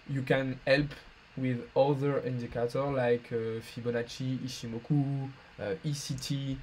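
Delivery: hesitant English in a heavy French accent. Real, not acted. Streamer explaining indicators to a viewer, French-accented English. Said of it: "mon accent absolument horrible".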